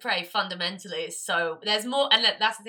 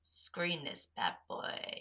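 low drawn-out verdict; 3 semitones lower, 13 dB quieter, much slower than usual. Real, not acted. Giving verdict, low and slow.

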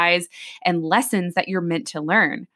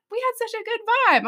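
baffled disbelief; faster than usual. Retold or imitated. Baffled by hate mail.

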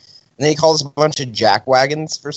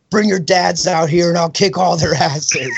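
higher-pitched mock threat; retold angry dad; 6 semitones higher than usual. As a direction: imitate a riled-up dad challenging teenagers. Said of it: "so pissed at us and yelling at us".

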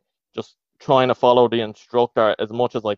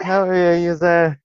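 pub regular; 5 semitones higher than usual. Retold or imitated. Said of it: "sound like a forty-seven-year-old in a pub".

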